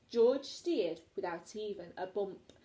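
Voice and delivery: ordinary delivery, nothing marked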